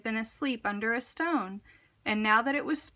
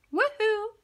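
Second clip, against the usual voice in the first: much higher happy holler; cheery shout; 10 semitones higher than usual. A narrator's reading. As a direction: call out waving to friends. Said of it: "he shouted".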